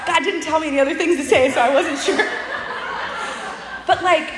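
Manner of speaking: high laugh spilling into words. Real, not acted. Preacher laughing at her awkward exit, high laugh through words.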